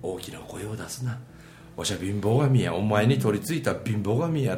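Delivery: a shabby god speaking lowly; lightly voiced poverty god. 貧乏神の台詞. (低めに抑えた貧乏神の声)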